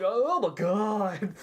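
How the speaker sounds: slow, stretched-out put-on voice; hammed-up, slow exclamation; mock queen's dismay. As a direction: play a put-upon queen, drawing out each word. Voices the attacked queen's reaction.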